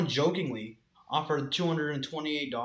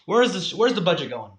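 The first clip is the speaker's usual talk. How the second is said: loud, indignant mock demand; mock outrage; 5 semitones higher, 9 dB louder than usual. Mock taxpayer questioning the school budget, loud mock outrage.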